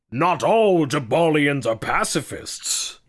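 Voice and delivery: slow, put-on NPC voice; hammed-up voice line; game character one-liner. Another quoted game voice line.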